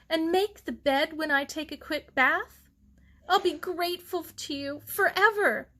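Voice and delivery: put-on young bride Mary. A narrator's reading. Bride begging her sister for help before her bath, high and rushed. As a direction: rush the favours out, breathless bride.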